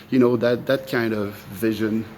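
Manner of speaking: ordinary talk, nothing marked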